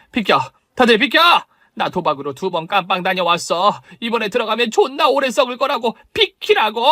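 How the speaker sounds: rising into high panicked yells; panicked fury. Acted. Caught gambler screams at the crowd to get out of her way, frantic yelling. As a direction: shove everyone aside in a frantic rage. Woman exposed, panicking and fleeing.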